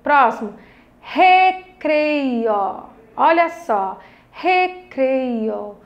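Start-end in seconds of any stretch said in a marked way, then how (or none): 1.0-2.8 three stretched syllables. Shown as a demonstration. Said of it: "Três pedacinhos". 4.3-5.8 slow, stretched three-part word; syllable by syllable. Shown as a demonstration. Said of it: "Três pedacinhos".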